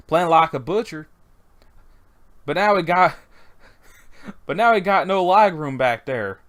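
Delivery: put-on lively Louisiana Southern accent; deep Southern accent. Asking the farmer whether the pigs are hungry, Southern accent. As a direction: backwoods Southern accent, loosely attempted. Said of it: "supposed to be having really deep southern accents".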